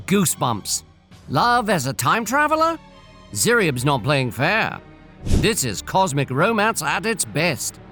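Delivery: ordinary delivery, nothing marked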